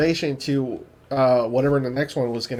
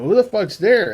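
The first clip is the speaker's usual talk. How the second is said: higher, louder demand; alarmed soldier; 6 semitones higher, 4 dB louder than usual. Retold or imitated. Soldier calling into the dark, mock alarm. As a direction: call out into the dark, half-played. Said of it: "I fucking stepped on someone".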